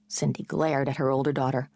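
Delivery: usual speaking voice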